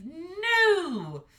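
high, loud blunt refusal; stern mother dragon. A narrator's reading. Mother dragon bluntly refusing her son, high and loud. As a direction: shut him down hard.

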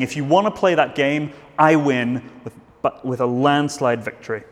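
ordinary talk, nothing marked

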